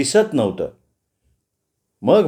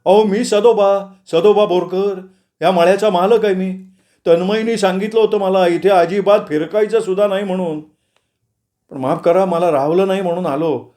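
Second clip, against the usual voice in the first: higher put-on voice for a farmer introducing himself; farm owner Sadoba; 5 semitones higher than usual. A narrator's reading. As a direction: play an apologetic farm owner, pitched up. Narrator plays the farm owner. (मळ्याच्या मालकाचा चढा, वेगळा आवाज)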